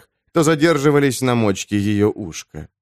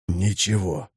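much softer sheepish answer; meek quiet; 4 semitones lower, 7 dB quieter, slower than usual. Acted. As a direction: mumble it sheepishly. Damian quiet reply.

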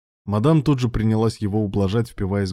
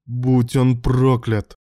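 loud, slow, raised-pitch curse; furious outburst; 4 semitones higher, 6 dB louder, much slower than usual. A narrator's reading. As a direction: blurt the curse out in rage. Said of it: "выпалил он в ярости".

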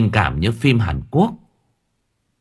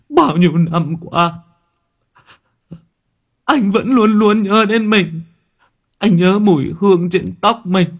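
much higher, louder overwrought cry of a longing husband; soap-opera lament, pitched up and pushed loud; hammed-up longing; 10 semitones higher, 5 dB louder than usual. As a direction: fake grieving husband clutching the medium, crying out theatrically. Husband fakes reunion with dead wife.

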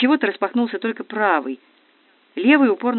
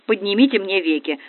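ordinary delivery

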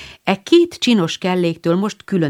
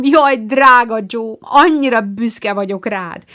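higher, louder, slower gushing pride; overjoyed; 5 semitones higher, 5 dB louder than usual. A narrator's reading. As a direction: gush with proud joy. Beth overjoyed at sister's success.

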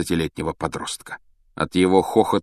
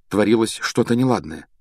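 usual speaking voice

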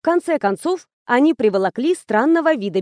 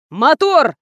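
raised, loud director's call; возопил; 4 semitones higher, 6 dB louder than usual. A narrator's reading. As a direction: call the take out loudly, pitched up. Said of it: "возопил режиссёр". (громкий режиссёрский выкрик)

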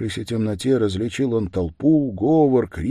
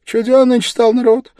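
very high, loud woman's voice; peasant woman; 12 dB louder than usual. A narrator's reading. Woman comments on the odd stranger, high loud voice. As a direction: pitch it right up as a loud woman onlooker. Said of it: "сказала баба".

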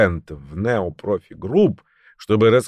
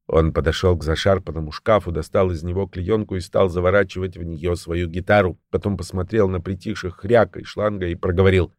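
usual speaking voice

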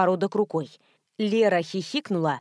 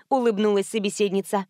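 ordinary delivery, nothing marked

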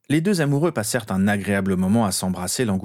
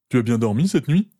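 casual question, pitched higher; conversational; 4 semitones higher, 4 dB louder than usual. A narrator's reading. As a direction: make light conversation, voice lifted. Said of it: "questionna Ron sur le ton de la conversation".

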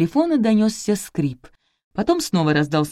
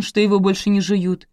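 plain reading voice

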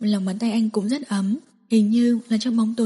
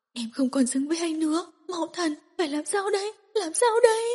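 from soft high sobs up to a much higher wail; desperate weeping wail; 7 semitones higher, 3 dB quieter than usual. Acted. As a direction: build from crying to howling despair. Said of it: "Cô gào khóc".

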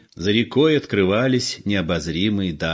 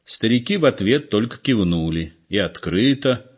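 plain reading voice

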